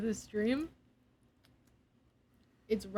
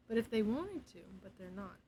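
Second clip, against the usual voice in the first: even softer, nearly inaudible; quiet; 8 dB quieter than usual. Shown as a demonstration. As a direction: drop almost to nothing. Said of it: "I'm talking so quietly".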